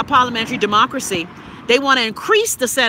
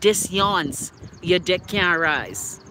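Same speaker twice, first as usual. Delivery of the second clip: slow drawl; 5 semitones lower, 6 dB quieter, much slower than usual. Retold or imitated. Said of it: "she take off her clothes and say".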